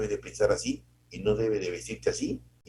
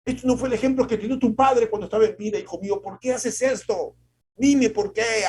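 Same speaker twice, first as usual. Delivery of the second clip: much higher and louder, a scolding parent; reproaching mother; 12 semitones higher, 7 dB louder than usual. Retold or imitated. A mother scolding her son for not following his father. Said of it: "nos han dicho".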